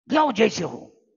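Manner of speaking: high, loud order to go at once; retold scolding. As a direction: snap out the command to run after him. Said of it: "हमको डांटा".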